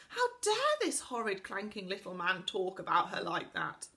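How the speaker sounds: ordinary delivery, nothing marked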